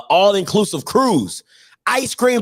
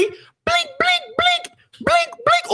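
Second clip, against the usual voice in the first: scanner beep imitation; much slower than usual. Mimicking registry scanner beeps.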